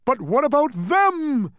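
high, loud, drawn-out cry of alarm; terrified cry. A narrator's reading. Bug spotting the other demons, terrified cry. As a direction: cry out in terror, stretching the words. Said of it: "cried the terrified bug".

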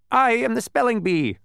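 high, proud bee voice; spelling bee voice. A narrator's reading. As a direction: announce yourself like a bee. Spelling bee character announces himself.

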